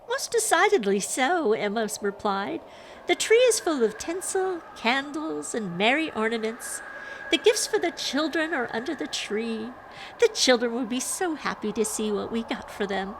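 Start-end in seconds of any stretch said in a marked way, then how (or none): 0.1-1.5 high, loud stagey agreement; play-acted cheer; cheerful Emma. A narrator's reading. 3.1-13.2 high, lilting play-acted voice; sing-song prepared dialogue; cheerful Emma. A narrator's reading.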